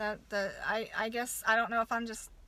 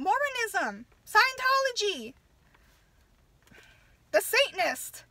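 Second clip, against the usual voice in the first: louder, higher furious ranting; full-voiced outraged tirade; real angry rant; 5 semitones higher, 8 dB louder than usual. Real, not acted. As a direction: shout down the excuse in real anger. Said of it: "I'm so pissed right now".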